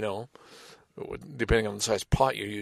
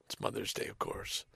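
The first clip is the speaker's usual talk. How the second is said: breathy under-the-breath remark; whispered aside; 6 semitones lower, 16 dB quieter than usual. Real, not acted. Storyteller aside about the restaurant being swamped, whispered.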